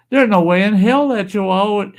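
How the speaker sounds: high-pitched, incredulous imitation of a biker; imitated disbelief. Biker rejecting the Chihuahua boast, imitated high disbelief.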